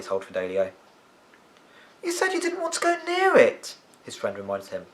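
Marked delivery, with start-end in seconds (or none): 2.0-3.7 put-on much higher, younger boy voice; lifted boy's voice; boy friend's voice. Fidelio voiced by narrator.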